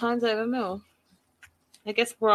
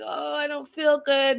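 high-pitched whiny moan; mock whining; 7 semitones higher than usual. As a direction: moan it like a patient wanting sympathy. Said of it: "she's whining some more".

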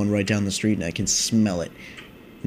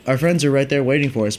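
ordinary talk, nothing marked

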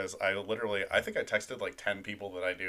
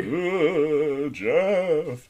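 drawn-out, spooky announcer voice; haunt the name like a ghost host; 7 semitones higher, 10 dB louder than usual. Acted. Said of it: "as our spooky voice".

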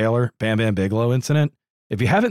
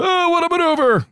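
very high, excited commentator cry; Vince McMahon; 4 dB louder than usual. Acted. Commentator hyping a wrestling move, excited high-pitched impression. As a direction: exclaim it like a hyped-up wrestling announcer. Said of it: "Vince minus the".

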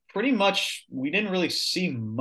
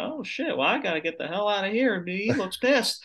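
bad southern accent; 7 semitones higher than usual. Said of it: "I can't do a good southern accent".

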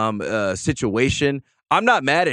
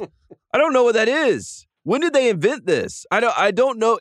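incredulous; 9 semitones higher than usual. Real, not acted. Incredulous about the Hustle Award.

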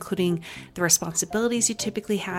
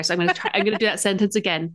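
ordinary delivery

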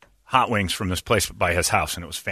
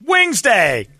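high loud Wings Day cry; excited cheer; 12 semitones higher, 5 dB louder than usual. Real, not acted. Host to Brady, hyped cheer for Wings Day. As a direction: shout it like a battle cry. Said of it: "you know how I feel about Wings Day".

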